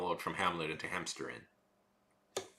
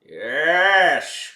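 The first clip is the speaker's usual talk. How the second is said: loud; 8 semitones higher, 15 dB louder than usual. Acted. Excited shout.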